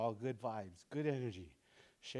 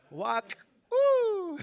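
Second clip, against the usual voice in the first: put-on much higher, louder "Walk, ooh" for the kids; kids' show host; 11 dB louder than usual. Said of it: "Can you repeat after me?".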